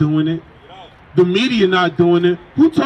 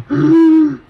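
loud, high choking gasp; a sharp gasp as if out of breath; gasping for air; 8 semitones higher, 5 dB louder than usual. Acted. Preacher acting the sick nurse, loud gasp.

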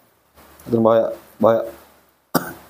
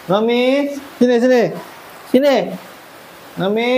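calling out; 9 semitones higher than usual. Real, not acted. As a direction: beckon the little one back. Calling the baby animal back. (memanggil dengan nada tinggi)

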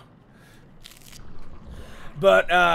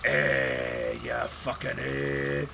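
much lower, slow, rough hillbilly growl; low gruff voice; 14 semitones lower, 9 dB quieter, much slower than usual. Shown as a demonstration. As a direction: drop into a deep gruff grumble. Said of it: "I'm more comfortable speaking in like".

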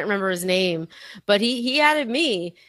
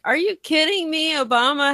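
raised-pitch, disbelieving retort; incredulous; 8 semitones higher than usual. Retold or imitated. Answering the left's complaints about Trump and war, incredulous. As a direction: challenge them with a high incredulous lift.

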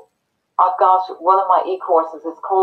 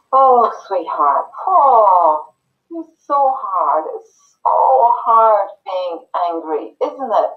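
tender empathy; 3 semitones higher, slower than usual. Shown as a demonstration. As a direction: feel the child's hurt as you soothe them. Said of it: "what they need is for you to go".

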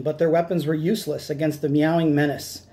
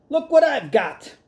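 shouting; 9 semitones higher than usual. Acted. Captain taunts Cat Kong with the package, shouting. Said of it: "he shouted".

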